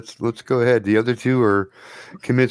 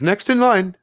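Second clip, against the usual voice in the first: high, projected call; lilting raised summons; 11 semitones higher than usual. Acted. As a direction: call the next patient over. Doctor calls the next patient.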